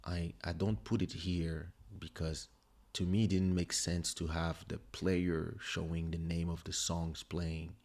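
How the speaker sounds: ordinary talk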